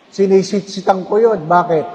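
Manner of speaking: ordinary talk, nothing marked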